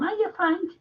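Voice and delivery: high, bright sing-song hiya from the tour crowd; crowd greeting. Retold or imitated. Tour group calling out greetings to Frank, high sing-song hiya. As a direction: call out hiya like a whole crowd of fans. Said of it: "people who were going".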